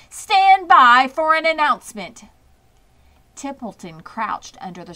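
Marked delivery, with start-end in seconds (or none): 0.1-2.2 loud, higher booming voice; booming announcer. A narrator's reading. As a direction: boom across the fairground. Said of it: "boomed the voice of the loudspeaker".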